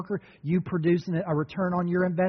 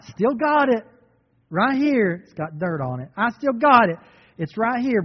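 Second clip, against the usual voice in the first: higher, louder smug servant showing off the gold; 4 semitones higher, 4 dB louder than usual. Acted. Preacher playing the servant proud to return the gold, higher and louder.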